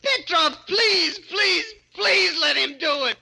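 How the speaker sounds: sobbing plea. Acted. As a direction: howl out a broken begging. Dwarf sobs and pleads with Petrov.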